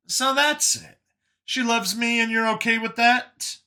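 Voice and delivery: high-pitched, probing character voice; Brian's questioning voice. Acted.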